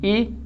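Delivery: ई said short and light, without stress; unstressed syllable. Shown as a demonstration. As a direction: drop ई in lightly, taking no time. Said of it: "time नहीं लग रहा". (ई हल्का, बिना ज़ोर)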